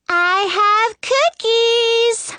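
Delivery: put-on high, slow singsong little-girl call. A narrator's reading. Disguised queen calls to Snow from outside, calling out. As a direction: draw it out high like a child selling sweets. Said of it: "the girl calls from outside".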